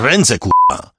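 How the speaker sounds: high, loud furious order; angry shout. A narrator's reading. Composer repeating his order with a curse, angry shout. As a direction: shout it with rising fury. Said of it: "krzyknął kompozytor".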